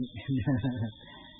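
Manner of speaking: short amused chuckle; light chuckle. Real, not acted. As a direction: chuckle briefly at your own remark. Jokes about reading dictionaries.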